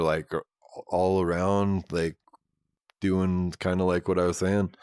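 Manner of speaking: normal talking voice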